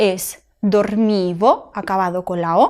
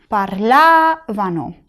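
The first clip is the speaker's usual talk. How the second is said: "Parlavano" slow, LA held long and raised; stress on la; much slower than usual. Shown as a demonstration. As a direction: stretch the LA in "Parlavano". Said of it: "La sílaba tónica de parlavano es".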